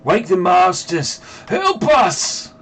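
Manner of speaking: high, strained monster scream; drawn-out, high-pitched plea; screamed cry for help. Acted. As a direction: cry out high in agony for the masters. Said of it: "one of them screams".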